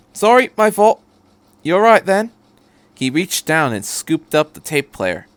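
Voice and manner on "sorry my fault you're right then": higher, louder, breezy apology; chirpy odd-accented stranger; Doctor's voice. Acted. The Doctor apologising to Shinji, chirpy and high. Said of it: "with an odd accent".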